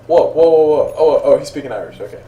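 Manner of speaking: loud, startled string of whoas; retold surprise. Call agent recalling a baffling Irish caller, mock-startled. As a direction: act out being thrown, then catching on.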